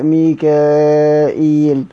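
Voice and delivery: ميكائيل stretched slowly, hamza and ya spelled out; reading variant. Shown as a demonstration. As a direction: say ميكائيل very slowly, each sound clear. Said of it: "بزيادة الهمزة والياء".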